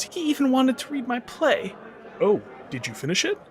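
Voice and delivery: ordinary delivery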